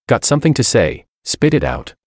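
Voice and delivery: ordinary delivery, nothing marked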